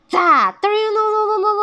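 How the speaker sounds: high, loud rolling sound effect; imitated tumbling noise. A narrator's reading. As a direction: voice a storybook launch and tumble. 模仿飞出翻滚的声音. (高声模仿翻滚音效)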